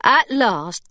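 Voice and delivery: usual speaking voice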